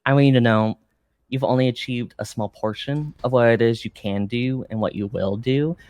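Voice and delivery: usual speaking voice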